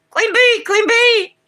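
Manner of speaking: high, loud honking bird call; stately honking crane. Pretend. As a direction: honk it out like a crane. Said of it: "did that sound like a whooping crane".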